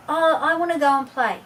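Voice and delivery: put-on high, loud little-kid whine; whining child. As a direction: nag for dessert right now. Child pleads against parent.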